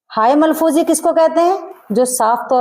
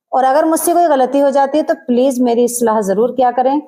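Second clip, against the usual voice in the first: ordinary delivery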